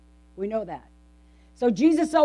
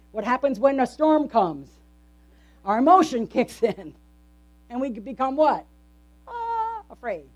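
ordinary talk, nothing marked

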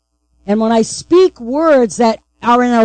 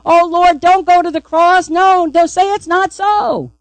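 higher, pleading voice for Peter; 6 semitones higher than usual. Retold or imitated. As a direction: beg him not to go, slowing at the end. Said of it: "when Peter said to him".

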